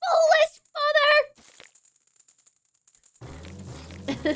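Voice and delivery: very high, loud scolding cry; furious princess. Acted. Princess scolds her father, high furious shout. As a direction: shriek it at a foolish parent.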